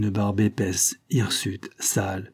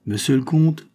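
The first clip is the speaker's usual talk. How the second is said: theatrical address, pitched up and grand; théâtral; 6 semitones higher, 4 dB louder than usual. A narrator's reading. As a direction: announce it with a stage flourish. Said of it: "dit-il théâtralement". (d'un ton théâtral)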